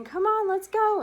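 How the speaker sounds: loud, slow, high demand; dad yanking his daughter's foot; insistent dad. Retold or imitated. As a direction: drag her off the post.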